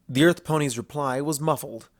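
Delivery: ordinary delivery, nothing marked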